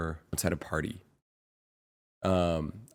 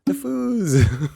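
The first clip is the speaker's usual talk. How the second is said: high, slow, bouncing cheer, louder at the end; excited bouncy imitation; 10 semitones higher, 6 dB louder, slower than usual. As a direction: proudly announce the family name like an excited friend. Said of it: "she's so excited".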